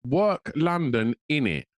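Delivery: "Work London, innit?" drawn out slowly in mock London slang; slow, puzzled imitation of a London lad; repeating the phrase slowly to puzzle it out; mimicking London slang, slowed. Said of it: "And I was like".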